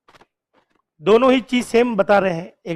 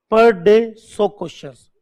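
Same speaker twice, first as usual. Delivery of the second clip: slow emphasis; much slower than usual. Shown as a demonstration. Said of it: "teacher stresses the daily target".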